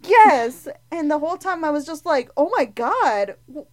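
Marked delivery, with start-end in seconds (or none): 2.4-3.7 louder, higher retold disbelief; shocked reaction. Recalling watching a gruesome death scene, shocked. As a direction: recount being aghast, voice jumping up.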